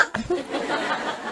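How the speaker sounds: short airy unvoiced laugh; breathy laugh. Real, not acted. Ustazah laughing at her joke about tour groups, airy chuckle. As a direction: let out a quick breathy chuckle.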